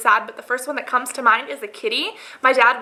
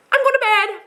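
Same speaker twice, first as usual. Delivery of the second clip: abrupt sign-off; 10 semitones higher than usual. Retold or imitated. Acted tired refusal of routine.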